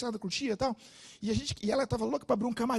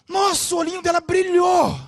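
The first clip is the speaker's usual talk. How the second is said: much higher and louder burst; excited exclamation; 10 semitones higher, 10 dB louder than usual. Real, not acted. Preacher telling the congregation about her joy, excited. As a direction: share the joy with the church. Narrating joyful moment.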